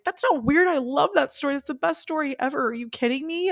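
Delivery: high-pitched, thrilled retold reassurance; delighted gushing. Podcaster replays reassuring her friend about the story, delighted. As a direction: gush with delight, voice way up.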